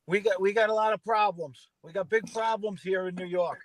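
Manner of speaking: your best New York accent. Shown as a demonstration. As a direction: lay on the New York accent for the radio hosts. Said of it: "In your best New York accent".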